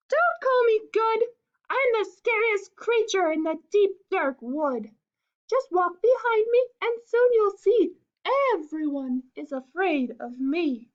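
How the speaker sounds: high, loud little mouse voice; high-pitched, emphatic storybook mouse; boastful mouse. Acted.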